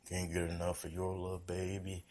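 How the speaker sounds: lightly done crooning love-song voice. Retold or imitated.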